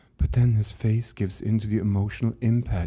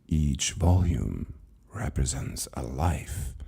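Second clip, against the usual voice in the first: an ancient librarian speaking with patient calm; calm old keeper; 3 semitones lower, slower than usual. Acted. Keeper calmly answers frightened Lucas, low and slow.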